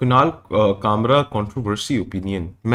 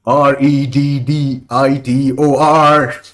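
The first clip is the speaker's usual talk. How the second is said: mock spelling; 4 semitones higher, 8 dB louder, much slower than usual. Spelling out Reddit mockingly.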